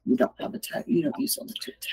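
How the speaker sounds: whispering. Real, not acted. Whispering at the table.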